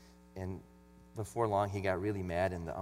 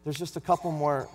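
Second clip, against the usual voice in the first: raised, sermon-style Bible reading; louder preaching read of John 9; scripture reading; 5 semitones higher, 7 dB louder than usual. Acted. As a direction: read the passage out like from the pulpit.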